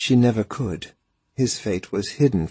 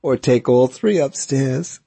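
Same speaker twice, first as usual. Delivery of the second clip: smirking; 5 semitones higher, 5 dB louder than usual. A narrator's reading. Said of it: "Her red-haired friend smirked".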